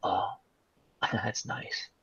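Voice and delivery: a quiet threatening killer, unbothered; low menacing psycho voice. Retold or imitated. Retelling the psychopath taunting the cop, low and soft. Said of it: "He goes".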